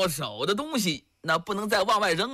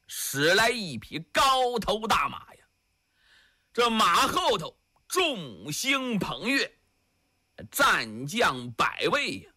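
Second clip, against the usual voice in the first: ordinary delivery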